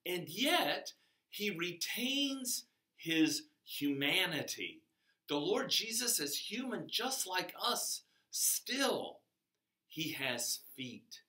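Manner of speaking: ordinary delivery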